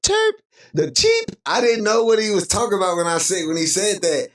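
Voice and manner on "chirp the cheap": sudden very high squeaked chirp; imitated chirp. Streamer mimicking the battery chirp, high-pitched squeak. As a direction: squeak it like a tiny electronic beep. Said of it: "Motherfucker said".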